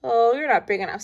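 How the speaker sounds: loud dismissive scoff; grouchy ladybug. A narrator's reading. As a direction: scoff and fly off. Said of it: "said the grouchy ladybug".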